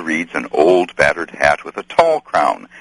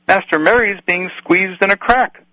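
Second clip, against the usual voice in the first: much higher, urgent and afraid; urgent cry; 13 semitones higher than usual. Acted. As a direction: cry it out desperately. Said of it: "cried Sam".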